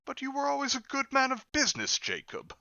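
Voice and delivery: put-on quavering high old man; faltering Scrooge. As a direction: stammer it like a nervous old miser. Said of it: "faltered Scrooge".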